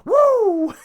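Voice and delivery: high, loud wrestler-style "Woo!"; a hammed-up ring cheer, way up high; mock wrestler woo.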